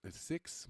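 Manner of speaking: "Sikhs" with an S start and short I; soft correction, "Sikhs" said as SIKS; corrected pronunciation. Real, not acted. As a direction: say "Sikhs" like "six". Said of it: "my bad, not the sheiks".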